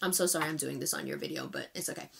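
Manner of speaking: low, soft apology, dropping away; apologetic aside. Real, not acted. Apologising for doing this on their video, softly. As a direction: murmur a quick sorry to the side.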